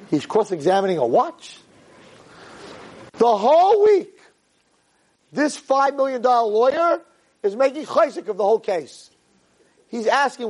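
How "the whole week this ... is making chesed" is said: exasperated outcry. Real, not acted. Storyteller exclaims in disbelief.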